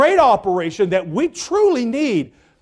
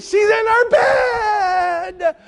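much higher, slow crying shout; exasperated cry; 10 semitones higher, much slower than usual. Retold or imitated. Husband crying out to his wife where the child is, exasperated shout. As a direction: wail it out, exasperated. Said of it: "I said".

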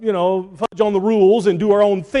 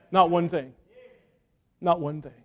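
Not one thing, quieter each time; fading repetition; 7 dB quieter than usual. Acted. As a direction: let it sink in softly. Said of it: "Not one thing we can do".

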